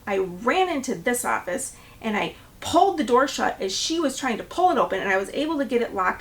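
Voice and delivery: normal talking voice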